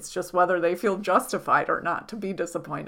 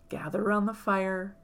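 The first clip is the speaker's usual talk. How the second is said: guided meditation; 6 dB quieter than usual. Acted. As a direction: gently lull the listener into trance. Leads a hypnotic journey.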